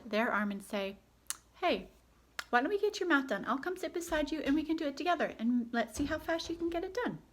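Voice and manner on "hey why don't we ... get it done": higher, friendly inviting tone. Retold or imitated. Parent inviting child to do math together, friendly. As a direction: coax gently like a helpful parent. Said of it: "See the difference".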